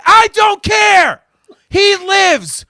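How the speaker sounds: high, loud, drawn-out protest; indignant shout. Real, not acted. Fan refusing to accept Han's death, indignant shout. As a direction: shout it in stubborn denial.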